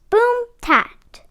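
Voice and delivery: ordinary delivery, nothing marked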